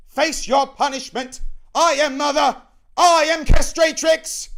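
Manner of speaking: grand, screamed proclamation; high, full-force tyrannical yell; shouting. Acted. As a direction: declare yourself at a piercing shriek. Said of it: "again shouts".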